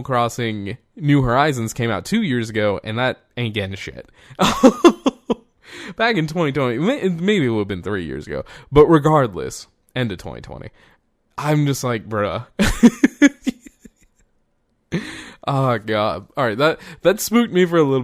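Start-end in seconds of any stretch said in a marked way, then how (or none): 4.3-5.3 high, loud chuckle; laugh. Real, not acted. Laughs at game getting nothing. 12.5-13.9 high, loud rising laugh. Real, not acted. Laughs after saying bruh.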